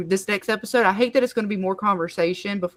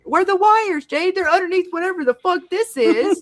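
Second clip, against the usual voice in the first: much higher, louder exasperated demand; hammed-up impatient caricature; mocking impatient voice; 10 semitones higher, 5 dB louder than usual. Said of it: "I, it".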